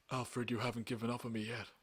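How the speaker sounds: soft, badly faked actor impression. Podcaster as Bruce speaking to Alfred, soft poor impression. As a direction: attempt the Batman actor's voice, hushed.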